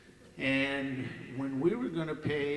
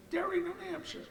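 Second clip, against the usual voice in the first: put-on high, girlish plea for a tow; young girl's voice; faster than usual. Girl at the pay window asking for a tow, high girlish voice. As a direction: voice the girl way up high and quick at the end. Girl answers where she lives.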